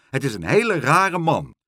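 loud, emphatic complaint; exclaiming. A narrator's reading. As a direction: call it out with punched words. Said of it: "riep Karel".

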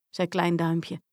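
ordinary delivery

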